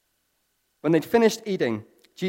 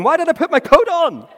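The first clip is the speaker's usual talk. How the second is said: much higher, louder, frantic comic Peter; Peter swimming; 11 dB louder than usual. Retold or imitated. Peter swimming to Jesus, high loud comic splutter. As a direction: splutter it mid-swim, baffled at himself. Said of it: "I imagine Peter doing the backstroke going".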